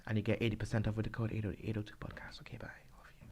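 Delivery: fast hushed whisper; whispered aside. Acted. Co-host whispers a fake promo code as a joke. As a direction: slip in the joke under your breath.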